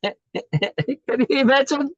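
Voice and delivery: very high, slow, laughing; laughing delight. Real, not acted. Teacher laughing at grass blades wanting specialness. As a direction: giggle it out with wonder.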